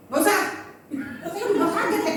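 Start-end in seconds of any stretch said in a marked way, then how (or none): 0.1-0.4 mock curt snap, slightly raised; higher-pitched, testy brush-off, acted; retold snappish refusal. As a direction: cut off the offer sharply. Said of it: "Saya bentak gitu".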